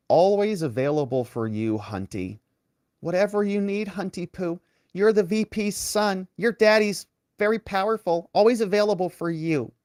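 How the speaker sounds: high, syrupy sucking-up voice, cooing Hunty Poo; a lightly voiced, gushing yes-man; mocking fawning assistant. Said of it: "Who talks like that?".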